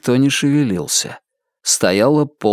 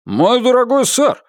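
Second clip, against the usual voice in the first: high, placating proprietor; high-voiced appeal to a customer; protesting proprietor; 12 semitones higher than usual. A narrator's reading. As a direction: plead your case in a pitched-up voice. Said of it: "начал хозяин".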